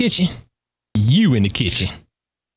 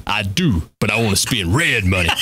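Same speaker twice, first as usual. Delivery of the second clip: lightly low bully; 9 semitones lower than usual. Retold or imitated. Bully answering in movie scene.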